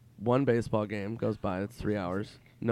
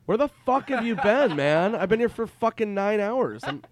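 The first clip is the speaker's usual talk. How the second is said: loud, high retold rant; exasperated outburst; 10 semitones higher, 7 dB louder, slower than usual. Waiting friend confronting the latecomer, acted exasperated shouting. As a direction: let him have it, loud and pitched up.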